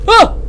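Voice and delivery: short, high, loud "uh"; startled yelp. Real, not acted. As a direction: yelp in sudden fright. Jump scare in horror game.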